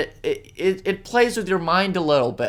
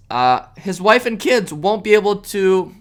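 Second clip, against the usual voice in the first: ordinary talk, nothing marked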